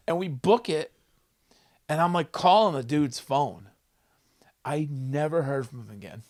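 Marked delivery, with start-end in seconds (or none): none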